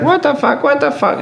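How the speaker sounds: high squeaky parrot mimicry; macaw impression. Owner shows what his macaw learned, squawky. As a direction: repeat it like a trained talking bird. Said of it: "she was like".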